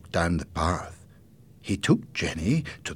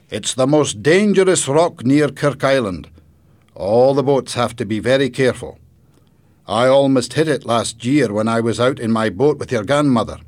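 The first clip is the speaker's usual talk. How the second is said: louder, higher grandfather voice; grandfather character; 6 semitones higher, 9 dB louder than usual. Acted. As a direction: project as an animated storybook grandfather. Grandfather explains the dangerous rock.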